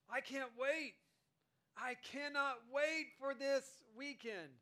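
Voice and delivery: can't contain the excitement; eager anticipation. Acted. Preacher voicing someone eager for the weekend, excited.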